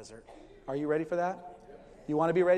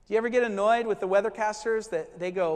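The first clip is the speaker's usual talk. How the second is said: normal talking voice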